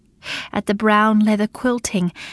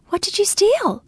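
much higher, astonished question; 12 semitones higher than usual. Acted. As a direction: ask in disbelief. Bella dumbfounded.

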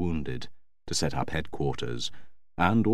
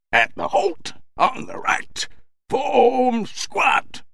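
high shouted parade-ground commands from afar; distant drill sergeant; much slower than usual. A narrator's reading. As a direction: bark drill orders across a square. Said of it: "a Royal Marine sergeant's voice from a great distance away".

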